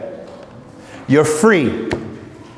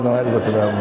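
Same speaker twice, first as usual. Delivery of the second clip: lower, softer, grudging voice; a skeptic frowning at the choice; doubtful mutter; 10 semitones lower, 5 dB quieter, much faster than usual. Retold or imitated. Mimics a doubtful reaction.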